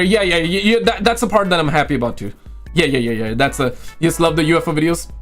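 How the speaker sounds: ordinary talk, nothing marked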